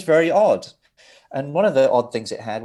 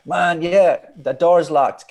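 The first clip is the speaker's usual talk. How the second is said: loud, higher imitated complaint; locked-out caller; 6 semitones higher, 9 dB louder, slower than usual. Engineer on the phone to John, loudly pleading. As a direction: press to be let in, raised voice.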